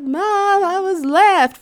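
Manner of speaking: put-on high, loud, whiny kid voice; upset kid. Kid pleading to her mom on the phone, high whiny voice. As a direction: plead like a left-behind child.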